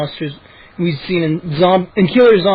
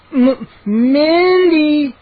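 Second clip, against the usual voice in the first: slow, high drawn-out moan; 9 semitones higher, 3 dB louder, much slower than usual. A narrator's reading. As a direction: moan the name weakly, stretching it out. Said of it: "I moaned".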